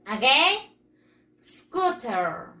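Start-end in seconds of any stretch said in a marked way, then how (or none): 1.3-2.5 SCOOTER with the last syllable drawn out and dropping low; little tune at the end. Shown as a demonstration. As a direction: model SCOOTER for repeating, pitch sliding down on "-ter". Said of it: "Ese tonito al final".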